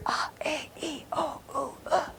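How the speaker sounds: each vowel voiceless and very soft; whispered vowels. Shown as a demonstration. Teacher shows learners they can all be whispered, whispered vowels. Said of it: "we can have a whispered".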